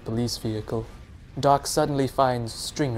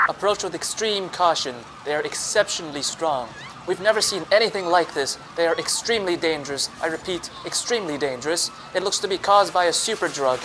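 radio dispatcher; 6 semitones higher, faster than usual. A narrator's reading. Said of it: "The voice continues on the radio".

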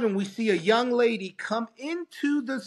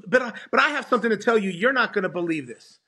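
rushed eager news; much faster than usual. Retold or imitated.

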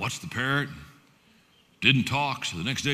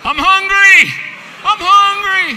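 loud cry of hunger; 19 dB louder, slower than usual. Acted. Preacher crying out as parrot.

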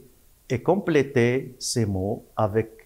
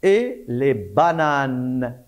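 slow, stretched reading for the class; slow syllable reading; slower than usual. Shown as a demonstration. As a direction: draw out each sound. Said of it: "On entend le son N". (lecture lente et détachée)

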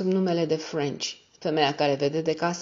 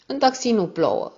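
ordinary delivery

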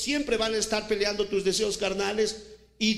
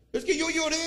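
high put-on whine with a laugh; mock whiny excuse; 10 semitones higher, 3 dB louder than usual. Preacher mocks a sinner who thinks crying is enough, high whine. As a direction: play a sinner pleading his tears, half laughing.

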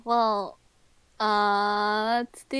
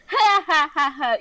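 mock evil laughter; 3 dB louder than usual. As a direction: fake an evil ha-ha-ha. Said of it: "Evil laughter insert here".

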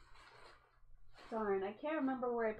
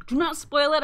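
loud, higher, urgent plea; loud warning; 6 semitones higher, 11 dB louder than usual. Real, not acted. Warning viewers not to spoil the show, loudly. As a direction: call it out so nobody spoils it.

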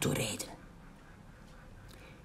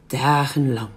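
ordinary delivery

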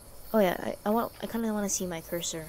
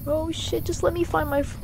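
high, anxious plea; panicked pleading; 9 semitones higher, 3 dB louder than usual. Real, not acted. Player begs game for peace, panicked pleading.

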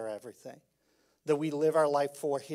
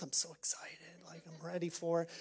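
breathy excited whisper; hushed delight about ice cream; giddy whisper; 10 dB quieter, faster than usual. Retold or imitated. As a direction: whisper it like a thrilled secret.

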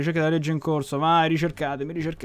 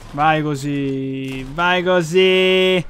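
long, slowly stretched syllables; drawn-out cheering; much slower than usual. Real, not acted. Gamer watching the bounty rise, slow drawn-out cheer. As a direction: stretch each word out in satisfaction.

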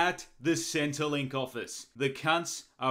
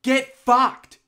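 screaming; 10 semitones higher than usual. Acted. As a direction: shriek every word at him. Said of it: "You shouldn't scream at me".

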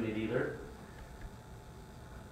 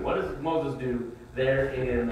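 normal talking voice

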